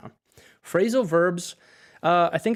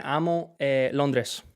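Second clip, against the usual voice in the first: usual speaking voice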